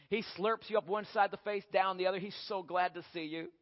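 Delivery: ordinary talk, nothing marked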